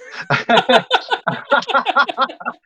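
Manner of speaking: high, loud burst of laughter; real laugh. Real, not acted. Teller amused by the clerk's reaction, bursting out laughing. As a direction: crack up at your own story.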